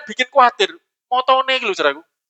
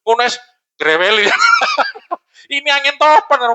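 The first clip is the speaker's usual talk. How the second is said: normal talking voice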